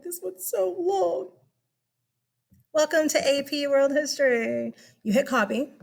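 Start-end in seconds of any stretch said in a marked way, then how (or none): 0.0-1.3 high, slow whining student; mock whiny student. Teacher mimicking students complaining about long pages, whiny. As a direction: whine like an overwhelmed student. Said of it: "You're like".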